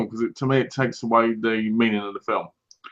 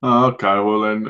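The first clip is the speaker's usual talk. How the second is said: normal talking voice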